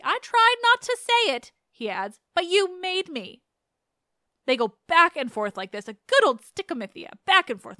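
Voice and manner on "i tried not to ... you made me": raised pitch, a touch of pleading; a hint of a reluctant seer; pained prophet. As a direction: blurt it out as if forced to speak. Tiresias answering the furious king.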